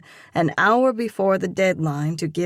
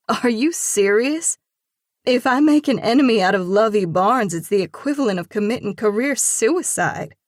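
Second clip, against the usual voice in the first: higher and louder, incredulous outburst; disbelieving; 5 semitones higher, 7 dB louder than usual. A narrator's reading. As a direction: protest in alarmed disbelief. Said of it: "A disbelieving laugh".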